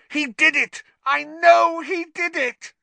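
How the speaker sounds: very high, loud, frenzied shriek; Snape shrieking. A narrator's reading. Professor insisting Potter is guilty, shrieking. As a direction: shriek the accusation, out of control. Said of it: "shrieked Snape".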